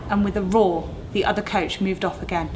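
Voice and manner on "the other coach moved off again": ordinary delivery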